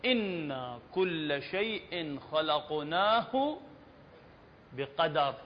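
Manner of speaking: slow, measured verse recitation; measured tajweed delivery; Quran recitation. Shown as a demonstration. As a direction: recite the verse unhurriedly with drawn vowels.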